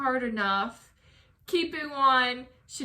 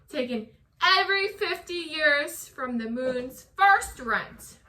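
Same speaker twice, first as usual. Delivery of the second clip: measured, dramatic poem recital; poem reading; 4 dB louder than usual. Acted. Reads her poem aloud.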